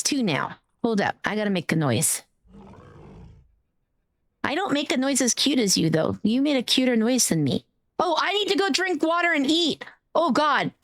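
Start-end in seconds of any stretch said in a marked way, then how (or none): none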